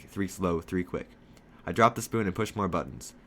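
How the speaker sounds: usual speaking voice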